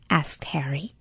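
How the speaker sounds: usual speaking voice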